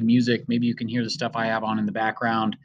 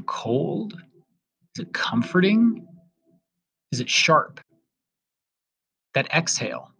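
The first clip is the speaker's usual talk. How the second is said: gentle prompts with long pauses between them; guided meditation. Acted. Host guiding listeners past distractions, in a guided-meditation voice. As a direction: lead them back to the breath, unhurried, lifting the questions.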